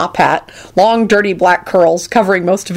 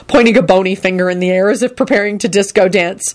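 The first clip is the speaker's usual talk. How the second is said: plain reading voice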